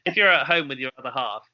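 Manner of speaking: ordinary talk, nothing marked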